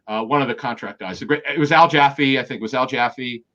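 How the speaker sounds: ordinary talk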